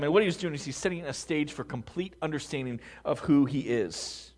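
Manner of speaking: usual speaking voice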